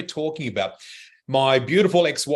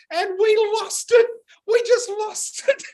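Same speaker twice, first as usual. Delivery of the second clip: high, breathless with laughter; losing it in giggles; laughing hard. Real, not acted. Telling a friend about the hat day, laughing hard. Hat twin on escalator, hysterics.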